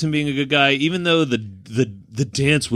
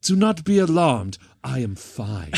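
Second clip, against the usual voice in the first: deadpan comic German henchman, pitched up then dropping at the end; German accent; 3 semitones higher than usual. Acted. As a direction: announce it stiffly in a mock German accent. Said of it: "his delivery is so fucking funny".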